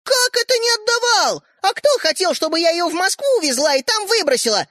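high-pitched, insistent retort; shouting indignantly. A narrator's reading. Sister argues back at brother, shouting. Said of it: "закричала Ариша".

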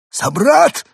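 high loud call to a brother; alien shout. A narrator's reading. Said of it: "выкрикнул Евгений". (громкий высокий выкрик пришельца)

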